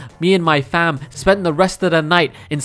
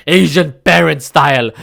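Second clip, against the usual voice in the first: normal talking voice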